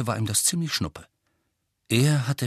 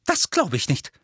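higher-pitched prompt dismissal; pert, higher disbelief; disbelieving aunt; 6 semitones higher than usual. A narrator's reading. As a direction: reject it at once.

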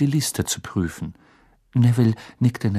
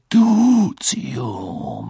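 slow, drawn-out spell; murmured incantation; much slower than usual. A narrator's reading. Teacher shrinking the spider back, slow murmured incantation. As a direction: let the spell glide downwards, slowly. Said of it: "murmelte Moody".

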